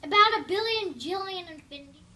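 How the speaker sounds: loud, thrilled rating; excited. Real, not acted. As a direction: gush it out loud. Exaggerated enthusiastic rating.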